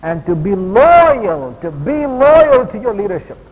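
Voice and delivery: loud, stretched-out key words, climbing high; ringing, drawn-out sermon emphasis; emphatic preaching. Acted. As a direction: hammer it home like a preacher.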